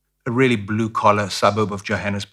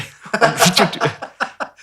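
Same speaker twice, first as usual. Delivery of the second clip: high laugh; 12 semitones higher than usual. Real, not acted. Laughing at own story.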